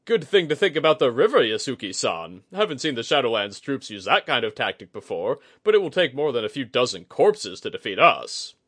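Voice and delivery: brash, loud bluster; boastful commander. A narrator's reading. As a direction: swagger through it like a proud general. Boastful commander Itsuji speaks.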